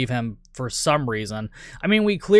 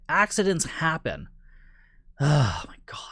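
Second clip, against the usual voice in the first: ordinary delivery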